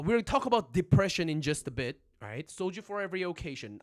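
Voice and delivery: normal talking voice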